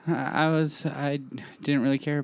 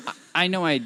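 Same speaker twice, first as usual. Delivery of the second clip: ordinary talk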